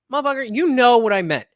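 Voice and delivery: loud, annoyed snap at a nitpicker; exasperated retort. Retold or imitated. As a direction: throw it back at him, fed up. Mock retort to nitpicking friend.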